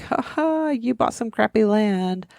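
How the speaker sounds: imitated crowd ridicule; mocking taunt. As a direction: tease them with a mock "ha ha". Retells everyone mocking the purchase.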